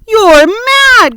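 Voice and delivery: loud, high outburst; shocked cry. A narrator's reading. Earthworm crying out at James's plan, loud and shocked. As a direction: cry out in alarm. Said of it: "cried the earthworm".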